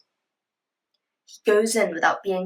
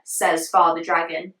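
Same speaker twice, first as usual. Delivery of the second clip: ordinary delivery, nothing marked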